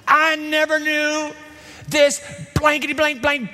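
high, heated mock swearing, blanking the curses; cursing fisherman. Peter swears at the bystanders, hammed-up cursing. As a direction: spit out a censored oath like a cornered fisherman. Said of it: "The air is blue with fishermen obscenities".

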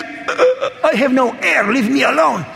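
mock strangled plea, pitched high; squeezed breathless. Small preacher crushed in a big man's hug, squeezed breathless. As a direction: gasp it out as if crushed in a bear hug. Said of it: "he squeezes me like".

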